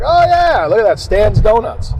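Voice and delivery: high, loud fake surprise at spotting the box; mock surprise. Host spotting the coworkers' donuts, putting on a loud high surprise. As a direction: play it like you just noticed the donuts.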